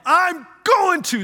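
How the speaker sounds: high, loud, slow mock retort; hammed-up annoyed reply; exasperated retort. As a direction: shout back in exasperation. Answering the yelling women.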